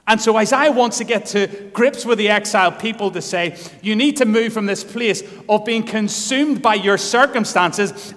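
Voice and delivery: loud, rising sermon cry; full-force preaching. Acted. Preacher urging his church, loud and rising. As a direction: preach it hard to the congregation.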